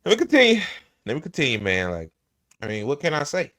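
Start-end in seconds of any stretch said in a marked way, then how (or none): 0.0-0.6 hammed-up, high and loud; mock pompous. Streamer mocking senator's bluster, loud hammed-up repeat. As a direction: puff it up like a speech.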